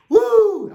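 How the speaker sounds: high, loud wrestler's woo; a wrestler's shrill, booming whoop; Ric Flair woo. Shown as a demonstration. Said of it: "you're gonna say".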